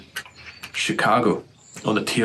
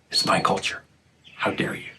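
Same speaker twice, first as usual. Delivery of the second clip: hushed mock snob, higher; 4 semitones higher than usual. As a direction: hiss it like a smug gatekeeper.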